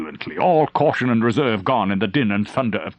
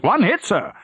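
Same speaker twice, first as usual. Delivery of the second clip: high, raised battle report over gunfire; shouted report; 12 semitones higher than usual. Acted. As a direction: call it out over the din. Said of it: "Frober shouted".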